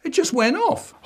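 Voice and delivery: put-on high whiny kid voice; boy pleading innocence to his dad; child voice. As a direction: squeak it out like a guilty little boy. The refrain again.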